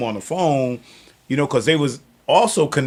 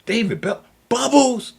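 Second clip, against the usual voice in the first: surprised recognition; 13 semitones higher than usual. Retold or imitated.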